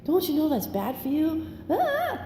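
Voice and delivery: high-pitched scolding parent ending in a squawk; hammed-up worried-parent nagging; mock frantic nagging. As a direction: fret at your child, then yelp. Acts out nagging her son.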